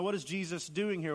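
ordinary talk, nothing marked

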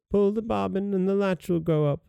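wolf posing as grandmother, high and gentle; softened wolf voice. Acted. Wolf luring the girl inside, softened high voice. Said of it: "softening his voice as much as he could".

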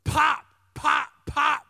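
loud, high popping noises; imitated loud pops. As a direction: punch out each pop sharply. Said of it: "out loud, you heard".

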